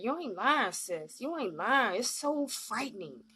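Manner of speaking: ordinary talk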